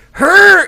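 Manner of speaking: loud, high imitated strain; mock straining grunt. Imitating Jay forcing the seatbelt, straining grunt. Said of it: "he's like".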